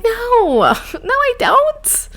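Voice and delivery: very high, drawn-out protesting no; squeaky high refusal. Retold or imitated. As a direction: squeal the refusal like a stubborn child. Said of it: "I'm like".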